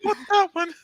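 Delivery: put-on childish demanding voice. Childish voice again.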